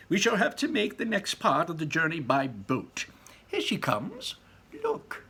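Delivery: high, eager showman; Wonka voice. A narrator's reading. Wonka shows the visitors his boat, high and eager. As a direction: point out the boat with delight. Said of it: "said Mister Wonka".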